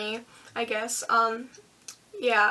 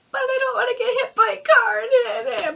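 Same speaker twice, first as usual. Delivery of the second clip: much higher, louder, whiny protest; scared whine; 10 semitones higher, 5 dB louder than usual. Retold or imitated. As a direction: plead like a rule-follower scared of the traffic. Worried quote at stoplight.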